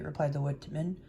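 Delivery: plain reading voice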